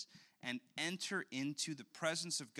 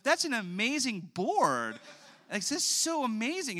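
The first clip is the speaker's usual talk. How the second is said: gushing strangers; 8 semitones higher, 8 dB louder than usual. Retold or imitated. Father imitating strangers gushing over his daughter's board. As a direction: praise a toddler's toy with delight.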